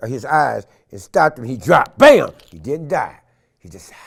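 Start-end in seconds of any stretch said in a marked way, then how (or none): 2.0-2.3 loud high mock shot; gunshot bam. Man miming the final pistol shot, loud bam. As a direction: fire one shot.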